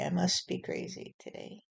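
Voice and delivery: the line again as a breathy whisper; whispered echo. Pretend. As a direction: let it fade into a whisper.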